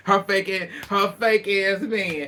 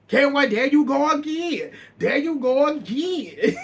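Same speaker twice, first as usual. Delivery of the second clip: higher, teasing imitation of Candy; sing-song mock of a friend, laughing; imitating Candy's taunt, higher and laughing; 7 semitones higher, 3 dB louder than usual. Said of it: "Candy like".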